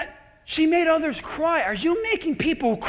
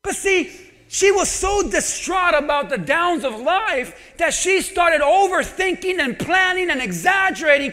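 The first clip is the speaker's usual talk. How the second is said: ordinary talk, nothing marked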